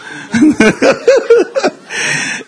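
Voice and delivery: a high, amused burst of laughter. Real, not acted. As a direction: laugh up high at your own story. Laughs at the mattress story.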